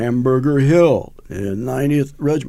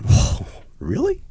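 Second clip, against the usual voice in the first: surprised; 11 semitones higher, slower than usual. Retold or imitated. Surprised by praise in bar.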